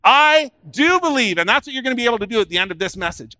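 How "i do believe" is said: loud, high and drawn out; rallying declaration. Shown as a demonstration. Preacher having the congregation repeat it, loudly declaiming. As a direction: lead the crowd in a slow, ringing cry. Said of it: "Say it again".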